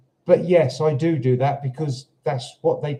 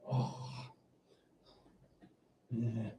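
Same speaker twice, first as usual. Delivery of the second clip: normal talking voice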